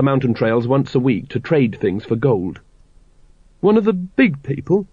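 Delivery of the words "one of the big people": higher, startled question; a lightly voiced surprised lad; astonished boy. A narrator's reading. Carrot asking his father in surprise.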